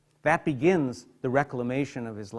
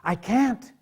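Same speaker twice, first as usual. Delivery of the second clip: [name] breaking; 7 semitones higher than usual. Acted. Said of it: "When that coffin door slides open".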